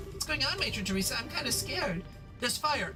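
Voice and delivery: put-on high, frightened little kid; scared child voice.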